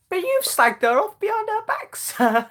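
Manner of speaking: very high, loud cheeky heckle; mouthy schoolkid. Retold or imitated. Mouthy kid answers maths teacher in assembly, high loud heckle. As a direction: yell back like a mouthy pupil. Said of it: "one of the mouthy kids".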